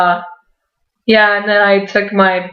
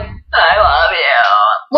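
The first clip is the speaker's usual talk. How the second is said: lovestruck; 6 semitones lower, much slower than usual. Retold or imitated. Gushing thanks for the pick.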